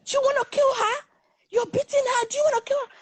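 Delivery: very high, alarmed questioning; shocked protest. Retold or imitated. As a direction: imitate a horrified friend pleading to stop. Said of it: "my friend was shocked".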